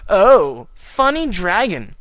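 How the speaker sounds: mock him with a slow sarcastic jab; heavy sarcasm. A narrator's reading. Twilight mocking Spike's teasing with loud heavy sarcasm. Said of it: "she said with heavy sarcasm".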